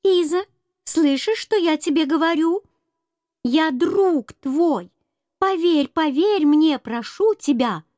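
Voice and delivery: high squeaky calling out; squeaky mouse voice. Acted. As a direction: squeak the name like a tiny mouse. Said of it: "пискливый голосок серой мыши".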